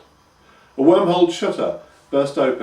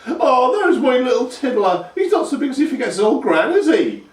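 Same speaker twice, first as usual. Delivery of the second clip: put-on cooing old grandma; 13 semitones higher, 7 dB louder than usual. A narrator's reading. Grandma cooing at her grown grandson. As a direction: coo over him like a doting gran.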